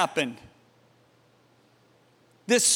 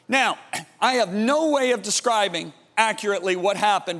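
ordinary delivery

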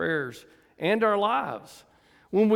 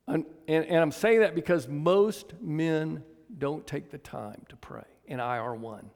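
usual speaking voice

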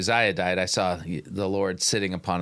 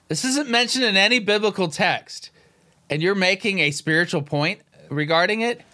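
higher and louder, exasperated disbelief; exasperated objection; 9 semitones higher, 4 dB louder than usual. Real, not acted. Commentator protesting the preacher's unbiblical point, exasperated and raised. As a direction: call out the nonsense, incredulous.

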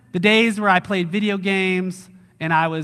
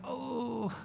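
soft, higher, shrinking-back "oh"; instant regret; 5 semitones higher, 15 dB quieter than usual. Acted. Believer shrinking back at Christ's return, regretful. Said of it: "instant regret".

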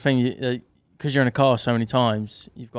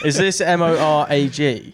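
laughing spelled-out question; 4 semitones higher, 5 dB louder than usual. Pretend. Spelling the name in jest.

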